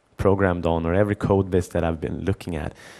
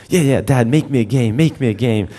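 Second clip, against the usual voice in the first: put-on higher, louder pleading kid voice; kid begging; 4 semitones higher, 6 dB louder than usual. Son begging his dad to make a game, eager kid voice. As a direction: beg like an eager little boy.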